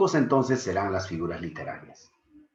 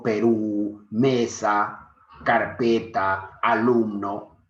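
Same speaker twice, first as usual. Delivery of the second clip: Perú, mesa, carpeta, alumno said slowly, one by one; slow word demo; much slower than usual. Shown as a demonstration. Teacher slowly saying sample words to the class. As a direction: spell out each word's sound slowly. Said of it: "Si yo digo".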